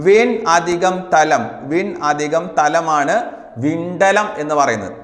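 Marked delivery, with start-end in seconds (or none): 0.1-3.1 slow dictation. Shown as a demonstration. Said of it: "എങ്ങനെ പിരിച്ചെഴുതാ".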